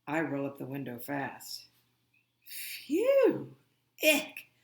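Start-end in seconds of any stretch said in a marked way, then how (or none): 2.4-4.5 much higher squeal of disgust; grossed-out yelp at the cow smell. Acted. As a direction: recoil from the stink. Davis smelling the cattle pens.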